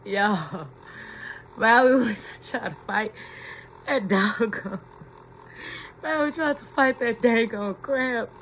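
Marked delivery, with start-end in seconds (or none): none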